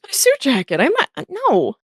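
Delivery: waiter's voice. Acted. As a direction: balk at giving up the jacket. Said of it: "kinda looks and goes".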